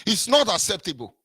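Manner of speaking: ordinary talk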